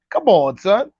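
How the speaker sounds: usual speaking voice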